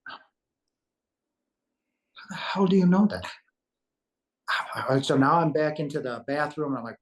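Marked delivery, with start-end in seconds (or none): 0.1-3.2 high, loud, half-whispered astonishment; reenacted shock, pitched way up; stunned disbelief. Stunned reaction to stranger's uncanny knowledge.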